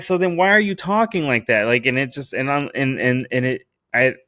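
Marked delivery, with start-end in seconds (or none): 0.0-1.7 high-pitched exasperated demand, retold; blowing up. Man confronting his date about her Spanglish, exasperated. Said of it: "I blew up after that".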